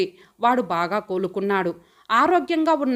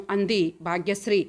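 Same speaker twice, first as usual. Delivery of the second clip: ordinary delivery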